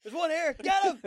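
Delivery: high laughing shout; rally the mob, loudly. Pretend. Mock calling out a mob.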